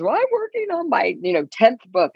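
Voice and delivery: jumps very high, imitating the lady; high imitated lady; lady's voice. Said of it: "she goes".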